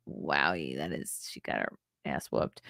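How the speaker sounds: whispered gloating aside; snide aside. Real, not acted. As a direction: mutter it under your breath. Snide aside after the vote count.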